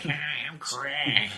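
slow, exaggerated nasal voice. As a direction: pinch it through the nose. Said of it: "I am the king of the nasally voice".

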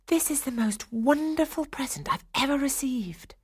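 put-on high, heartfelt girl's voice; exclaiming. A narrator's reading. Clara thanking her godfather for the nutcracker, exclaiming. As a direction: gush in a high voice, meaning it. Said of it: "Clara exclaimed".

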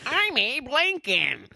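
very high, drawn-out mock presidential voice; Abe Lincoln. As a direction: announce yourself in a silly, slow impression. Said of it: "all you have to do is say the name".

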